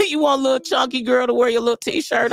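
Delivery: usual speaking voice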